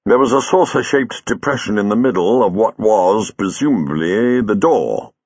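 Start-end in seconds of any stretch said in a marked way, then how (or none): none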